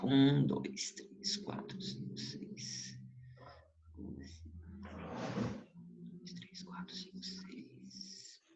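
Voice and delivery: whispered counting, almost silent; counting under breath. Real, not acted. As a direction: count quietly to yourself. Counting under breath during draw.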